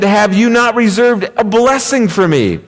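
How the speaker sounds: high-pitched, mildly wounded plea; tearful plea. Acted. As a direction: plead with a touch of tears. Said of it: "With tears in his eyes".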